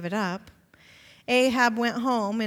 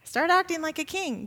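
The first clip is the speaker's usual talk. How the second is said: higher, firm order; commanding wife; 6 semitones higher than usual. Acted. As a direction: order him up like a strict queen. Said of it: "And she tells him".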